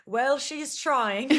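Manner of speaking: English narrator. Pretend. Mother imagining her life's narrator, in a slow English accent. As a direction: narrate her life like a dry English storyteller. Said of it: "I don't know why the narrator's English".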